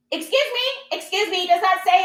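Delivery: high-pitched mock Karen butting in; insistent interruption. Pushy shopper cutting in on someone at a sale, insistent.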